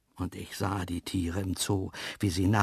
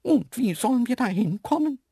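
very high, louder animal voice; puzzled antelope; 13 semitones higher, 5 dB louder than usual. Acted. Antelope questioning the trip, high puzzled voice. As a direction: ask in high-pitched puzzlement.

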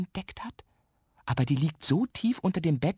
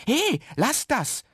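high, drawn-out protesting call; loud scolding; 13 semitones higher, 4 dB louder, slower than usual. A narrator's reading. Boy stops the sweet thief, loud scolding. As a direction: scold in a high, slow cry. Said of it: "schimpft Jan Ole laut".

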